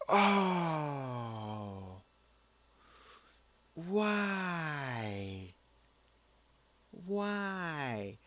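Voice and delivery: long, drawn-out falling wails; mock despair. Vlogger despairing at yet another nude character, hammed-up wail. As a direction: wail it out in exaggerated dismay.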